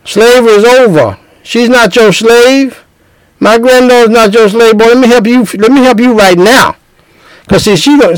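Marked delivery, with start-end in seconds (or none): 0.0-6.8 higher, firm grandmother voice; Big Mama, loud and clear. Retold or imitated. Grandmother tells the young husband slavery is over, firm and emphatic. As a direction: lay it down plain so nobody misses it. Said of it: "Big Mama gonna say it loud and clear".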